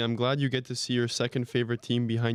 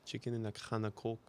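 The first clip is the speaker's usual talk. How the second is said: put-on Scouse accent; 9 dB quieter than usual. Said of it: "No, you fucked up".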